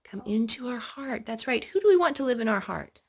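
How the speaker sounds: usual speaking voice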